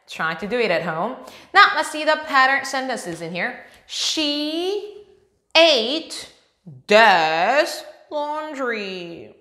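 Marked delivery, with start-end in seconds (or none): 3.9-9.4 very slow. Shown as a demonstration. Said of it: "pattern sentence read slowly".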